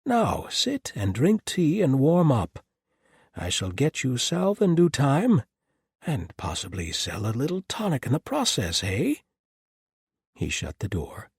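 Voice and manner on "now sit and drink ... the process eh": put-on higher, bright-spirited old trader; a jolly peddler reassuring his guests; cheerful Doc Mason voice. Doc Mason offers tea.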